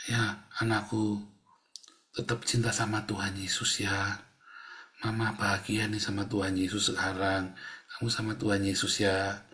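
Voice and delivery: quiet loving farewell; tender dying mother. Retold or imitated. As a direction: gently reassure the child from the deathbed. Dying mother comforts child.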